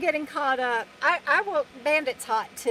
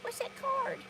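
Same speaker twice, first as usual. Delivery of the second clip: high, soft little dog voice; 10 semitones higher, 9 dB quieter than usual. Pretend. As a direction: speak for the dog, high and hushed. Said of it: "Bandit says".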